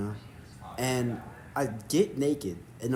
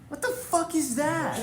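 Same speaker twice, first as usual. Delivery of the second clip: high, slow, outraged disbelief; shocked; slower than usual. Retold or imitated. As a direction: squeal it in horror. Shocked at news of pubes.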